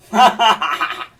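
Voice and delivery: loud, high staccato cackle; mock villain's secret glee, laughed out loud; high, rapid ha-ha-ha of a schemer; gloating inner laugh. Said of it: "When inside he's going".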